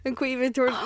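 ordinary talk, nothing marked

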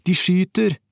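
high-pitched cry of warning; shouted alarm. A narrator's reading. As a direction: shout that danger is here. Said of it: "brølte jeg".

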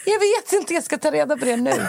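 high-pitched baffled reply; answering her friend, puzzled and high. Retold or imitated. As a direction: squeak it out, totally thrown. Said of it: "Jag bara".

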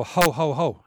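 hearty ho ho ho laugh, breathing out; demo laugh. Shown as a demonstration. Speaker demos how adults laugh, a hearty ho ho. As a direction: show your own laugh on the out-breath. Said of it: "That's me laughing".

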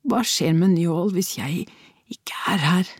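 unvoiced worried whisper. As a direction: breathe it out in a whisper. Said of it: "hvisket hun".

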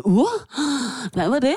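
hammed-up nervous uh; mock startled. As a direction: act spooked by a shout. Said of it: "og er sådan".

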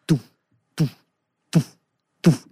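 short, evenly spaced "doof" thumps; imitated remix beat. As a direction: mimic a dance beat with your mouth. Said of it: "it was like a remix".

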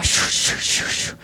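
rhythmic voiceless whooshing; imitated roaring blood. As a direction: imitate blood rushing in the ears with repeated shushes. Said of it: "blod dåna i öronen". (härmat dånande blod i öronen)

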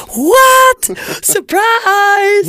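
squealed high and loud, hammed-up astonishment; mock gasp of surprise, way up high; over-the-top fake shock at an obvious twist. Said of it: "Det är inte så att jag bara".

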